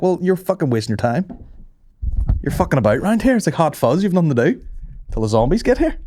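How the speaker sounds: high-pitched mock outrage; indignant retort. Driver telling cop he's wasting his time, high mock indignation.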